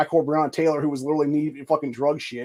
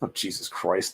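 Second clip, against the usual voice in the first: ordinary talk, nothing marked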